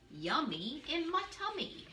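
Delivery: sing-song "Yummy in my tummy"; bouncy and playful, high; playful rhyme. Acted. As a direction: tease the kids with the rhyme.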